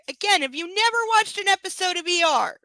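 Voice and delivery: loud, high incredulous question; fed up with the character's cluelessness; exasperated disbelief. Real, not acted. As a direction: demand it loudly, pitch way up. Reader rants at the story.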